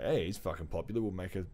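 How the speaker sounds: ordinary delivery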